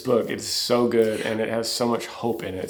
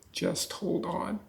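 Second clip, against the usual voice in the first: whispered comfort; 9 semitones higher, 8 dB quieter, slower than usual. Retold or imitated. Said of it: "I could almost hear him whispering".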